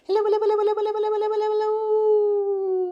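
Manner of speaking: rapid-fire, high-pitched hellos; as fast as possible. Shown as a demonstration. Librarian playing a hello game with babies, super fast. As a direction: rattle them off in a high, unbroken stream. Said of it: "as fast as we can".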